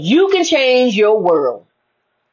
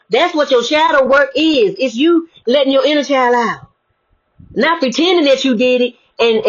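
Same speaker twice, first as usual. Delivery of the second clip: ordinary talk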